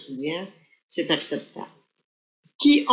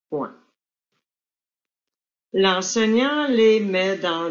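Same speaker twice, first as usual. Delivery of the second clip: slow, measured dictation with spoken punctuation; dictation reading. Shown as a demonstration. Teacher dictating an exam text, slow and deliberate. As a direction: read each phrase slowly so pupils can write it down.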